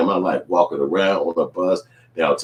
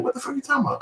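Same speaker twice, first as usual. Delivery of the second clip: mock innocent; 6 dB quieter, much faster than usual. Friend playing dumb.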